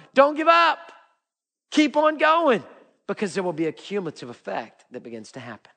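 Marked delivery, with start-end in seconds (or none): 0.1-2.6 high, forceful preacher's urging; rousing exhortation. Real, not acted. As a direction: cry it out to rally the congregation. Preacher exhorting the congregation to persevere.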